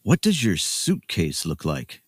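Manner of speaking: ordinary delivery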